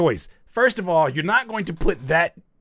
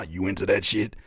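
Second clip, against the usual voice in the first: dropped, much lower quote; deeper voice; 7 semitones lower than usual. Retold or imitated. Mocking friend's sudden change of interest, deeper voice. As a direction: drop the pitch into a deep, insinuating question. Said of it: "Why your voice get deeper?".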